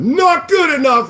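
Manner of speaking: high, mocking whine of a dismissive commenter; mocking whiny voice. Streamer mocking a dismissive commenter, high whiny mock voice. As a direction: sneer it like a snooty critic.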